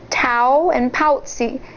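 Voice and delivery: ordinary delivery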